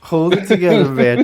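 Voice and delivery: laughing through the words. Real, not acted. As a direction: say it while laughing. Echoing with laugh.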